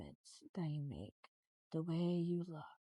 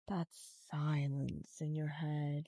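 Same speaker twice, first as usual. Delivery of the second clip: ordinary talk, nothing marked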